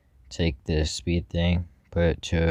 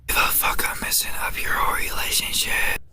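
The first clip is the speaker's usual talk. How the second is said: whispering. Shown as a demonstration. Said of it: "Here's the whisper stuff".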